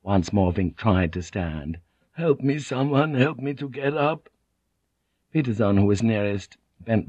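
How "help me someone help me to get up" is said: higher, helpless pleading; weak plea. A narrator's reading. Doomed Vink asking his mates for help standing, pleading. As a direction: beg for a hand up.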